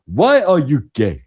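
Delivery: a mild mock Nigerian accent on the question; hint of a Nigerian accent, quoting a host mimicking it. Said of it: "He does the accent".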